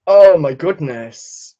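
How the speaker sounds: slow, drawn-out amazement; marvel, stretching the first word; stunned delight. Real, not acted. Still stunned by famous follower.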